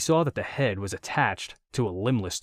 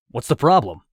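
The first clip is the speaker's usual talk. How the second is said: urgent question; 4 semitones higher, 10 dB louder than usual. A narrator's reading. Officer answers alarmed woman.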